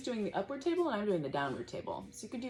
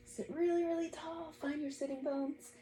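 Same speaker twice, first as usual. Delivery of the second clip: encouraging lift; 9 semitones higher than usual. Real, not acted. Yoga teacher guiding child upright.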